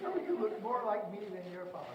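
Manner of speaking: put-on high, sing-song baby talk; lilting parent cooing at a toddler; sing-songy parentese. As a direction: coo it like a doting parent. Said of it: "that sing-songy thing".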